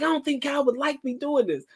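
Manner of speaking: put-on prim girl. Voicing the religious girl.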